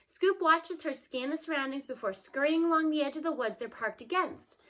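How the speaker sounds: ordinary delivery, nothing marked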